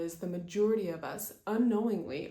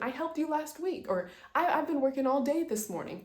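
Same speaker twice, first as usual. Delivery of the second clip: husband protests in a higher, defensive voice; 7 semitones higher than usual. Retold or imitated. Husband defends himself to his wife, mildly defensive and higher. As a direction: pitch up to argue his case. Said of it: "now he's defending".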